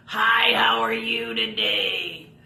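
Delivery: loud, slow, hammed-up puppet greeting; Rory puppet voice. Puppeteer introduces Rory to the kids, loud and slow. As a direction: boom out a puppet hello. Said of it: "introduce you to my friend Rory".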